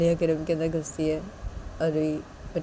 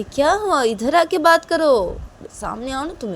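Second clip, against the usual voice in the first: high, loud, slow confrontation; angry challenge; 7 semitones higher, 6 dB louder than usual. Acted. Ruhi angrily daring Piyu to come closer.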